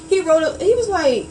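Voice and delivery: telling her friend the story, voice rising up and excited; excited retelling. Real, not acted. Excited retelling, loud and high.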